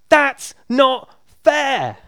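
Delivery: high, slow indignant whine; whiny protest. Retold or imitated. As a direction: drag out the complaint like a sulking student. Student protests to teacher.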